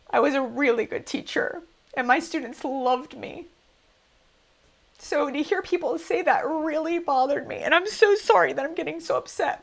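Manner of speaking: upset and close to sobbing; crying. Real, not acted. Defending her teaching to viewers, crying. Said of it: "I wasn't planning on crying".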